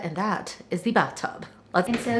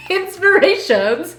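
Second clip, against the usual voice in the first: playful put-on British accent, high and loud, slowed; mock British accent; 8 semitones higher, 8 dB louder than usual. Host jokingly reads the title in a British accent. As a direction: Inspirations drawn out in a giggly British accent. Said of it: "Say it with the British accent".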